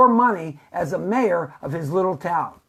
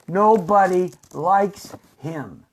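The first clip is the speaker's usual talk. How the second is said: each word of "Nobody likes him" set apart; slow, spaced out; slower than usual. Real, not acted. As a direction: drag it out word by word for emphasis. Same line repeated, first spaced out.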